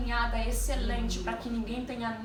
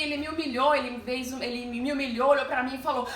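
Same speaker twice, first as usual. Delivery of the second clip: usual speaking voice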